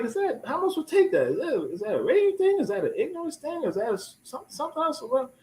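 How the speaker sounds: bewildered questioning. Retold or imitated. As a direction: sound lost for how to take it.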